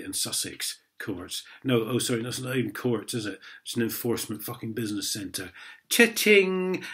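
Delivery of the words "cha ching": mock till sound. Mocking money-grabbing court.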